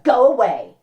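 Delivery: loud curt go away; dismissive. A narrator's reading. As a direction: brush him off. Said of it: "said Athena".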